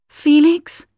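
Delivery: high, frightened call of a name; scared cry for her cousin; fearful call. A narrator's reading. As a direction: call out lost in the dark. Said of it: "she called fearfully".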